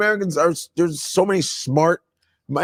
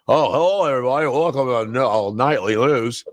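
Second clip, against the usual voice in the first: a hint of a stiff evening anchor who can't say his L; Tom Brokaw impression; 5 semitones lower than usual. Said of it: "Tom Brokaw couldn't say his L".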